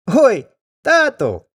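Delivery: high, loud call to his father; boy crying out. Acted. Boy calling out to his father, high and loud. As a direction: shout a warning to dad. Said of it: "каже хлопець".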